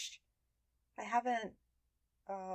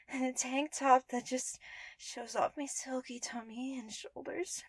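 put-on giggly, higher cute anime girl voice; 8 semitones higher, 6 dB louder than usual. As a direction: squeal with delight at your new voice. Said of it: "oh, I sound like an anime girl".

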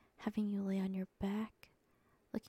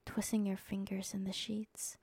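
usual speaking voice

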